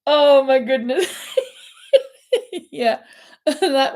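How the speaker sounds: laughing through the words. Real, not acted. Host laughs at asking questions in the wrong order, laughing. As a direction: crack up at your own slip.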